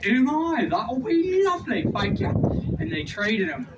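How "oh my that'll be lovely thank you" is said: high, lilting imitation British voice; mock British accent. Tour guide mimics the British accepting a trade, mock British accent. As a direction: play a delighted Brit, pitched up. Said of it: "Couldn't tell our voices apart".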